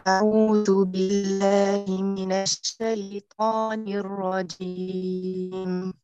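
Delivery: ordinary delivery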